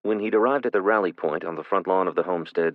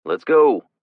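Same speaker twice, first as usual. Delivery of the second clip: loud call from outside, a touch higher; 6 semitones higher, 10 dB louder than usual. A narrator's reading. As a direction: call them out the door, urgent. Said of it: "Jason called through the front window".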